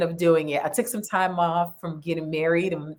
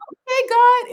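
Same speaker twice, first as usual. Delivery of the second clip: much higher, louder exclamation; amazed okay; 7 dB louder than usual. Retold or imitated. Telling the host her surprise at the growth. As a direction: marvel at God's doing.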